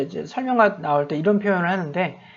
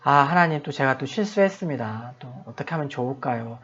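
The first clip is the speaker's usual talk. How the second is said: low, soft retold prayer; hushed, regretful appeal; troubled confession; 4 semitones lower, 7 dB quieter than usual. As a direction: confess a mistake quietly to God. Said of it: "마음 내내 불편하고 하고 있다가".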